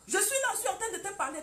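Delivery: loud, high and fast, a scolding husband. Acted. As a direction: bark it at her like an irritated husband. Husband scolding his wife. (mari qui gronde sa femme, fort, aigu et rapide)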